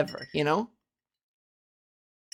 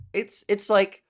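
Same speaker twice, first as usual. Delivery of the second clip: normal talking voice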